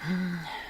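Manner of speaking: sad sigh. Acted. Lovers parting, she is sad.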